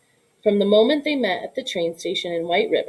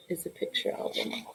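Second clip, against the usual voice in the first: whisper; 2 semitones lower, 14 dB quieter than usual. Real, not acted. Unvoiced aside.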